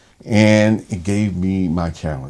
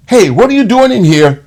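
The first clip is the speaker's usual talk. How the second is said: loud, high, angry yell at the nurse; angry shout; 13 semitones higher, 9 dB louder than usual. Retold or imitated. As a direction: bark it out like a startled angry patient.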